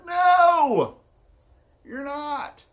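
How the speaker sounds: high, drawn-out shouted denial; acted climactic outburst; emphatic shout. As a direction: shout him down, stretching the no. Friend's climactic contradiction, acted.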